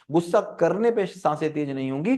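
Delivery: ordinary talk, nothing marked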